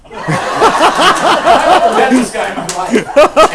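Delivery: ordinary talk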